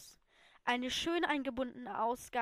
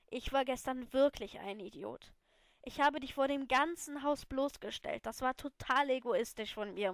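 plain reading voice

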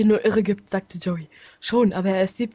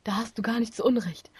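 soft unvoiced whisper; 7 dB quieter than usual. Real, not acted. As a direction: whisper it as a side remark. Whispered aside.

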